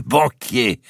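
delighted cry, banquier soaring up; beaming shout. A narrator's reading. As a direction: shout banquier with a wide grin. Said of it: "s'écria d'Artois avec un grand sourire".

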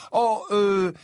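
stall, caught off guard; slightly lost. A narrator's reading. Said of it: "un peu perdu".

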